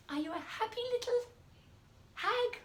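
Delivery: high, quick chirpy little hag; happy little hag. Shown as a demonstration. Teacher showing class a happy hag voice. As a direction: bounce up high like a cheerful tiny hag. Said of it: "Think about the facial expression and the voice".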